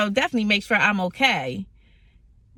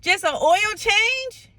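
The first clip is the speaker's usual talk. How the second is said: higher and slow, disbelieving; incredulous; 8 semitones higher, slower than usual. Retold or imitated. Asking her car, slow high disbelief. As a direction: drag it out in disbelief, rising higher. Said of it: "so I'm like".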